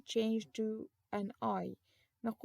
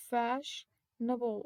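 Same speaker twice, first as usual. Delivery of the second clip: slow model word; much slower than usual. Shown as a demonstration. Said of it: "teacher models word".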